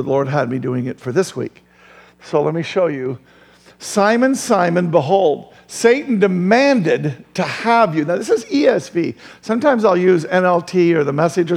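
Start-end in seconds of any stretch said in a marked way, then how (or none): 3.8-8.0 slow, weighty reading of the verse; scripture reading. Acted. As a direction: let the words land slowly. Reading Jesus's words slowly.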